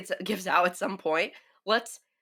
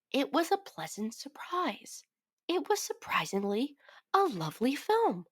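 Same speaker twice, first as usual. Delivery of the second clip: soft high whisper; 5 semitones higher, 6 dB quieter than usual. Real, not acted. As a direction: breathe it out in a whisper. Whispered aside about the film.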